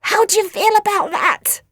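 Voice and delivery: high, strained dying voice; gasping demand. A narrator's reading. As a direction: gasp the question out in mock agony. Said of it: "gasped Nelly".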